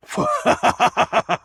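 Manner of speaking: villainous laugh; evil cackle. Acted. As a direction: cackle at the mortal's fear. Villain gloats.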